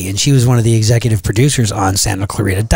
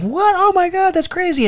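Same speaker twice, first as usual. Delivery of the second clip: much higher, gushing astonishment; mock amazed gushing. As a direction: squeal it like starstruck actresses. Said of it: "And they're like".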